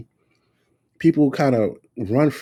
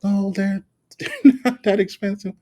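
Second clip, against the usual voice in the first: high, laughing mock of the ex-wife; 11 semitones higher, 3 dB louder than usual. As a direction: ham up her breezy dismissal. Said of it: "my fucking ex-wife used to say that shit".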